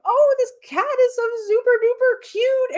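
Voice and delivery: put-on high gushing baby-talk over a cute cat; cutesy gushing. Podcast host gushing at a cat video, high cutesy coo. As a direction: coo it up high like melting at a kitten. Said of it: "like, cutesy feeling".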